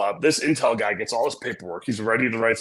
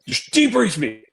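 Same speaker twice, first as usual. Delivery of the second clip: high-pitched mock outburst, indignant; mad intel officer; 12 semitones higher than usual. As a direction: act out an officer losing his temper. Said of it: "this intel officer gets mad".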